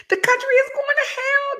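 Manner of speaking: high squealing outrage; imitating a furious colonel's outburst; mock outraged colonel. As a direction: ham up the colonel's doom cry.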